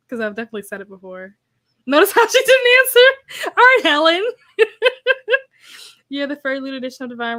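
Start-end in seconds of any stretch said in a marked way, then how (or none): 1.8-4.2 high, loud words squeezed through laughter; real helpless laughter over the words; laughing hard. Real, not acted. Cracks up reading teasing chat comment. 4.6-5.4 high, loud burst of laughing; real uncontrolled giggling; laughter. Real, not acted. Cracks up at chat comment.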